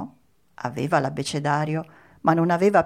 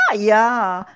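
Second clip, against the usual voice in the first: louder cry of "ouch"; sudden pained yelp; 4 semitones higher, 8 dB louder than usual. A narrator's reading. Geppetto kicked by his new puppet, pained yelp.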